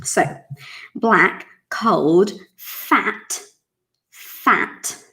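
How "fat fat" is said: "fat" stopped hard on the t; clipped fat. Shown as a demonstration. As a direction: end "fat" sharp with no vowel. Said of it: "It stops there".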